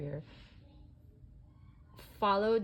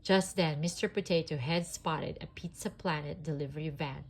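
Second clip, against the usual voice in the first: usual speaking voice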